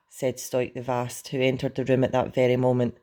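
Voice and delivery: ordinary delivery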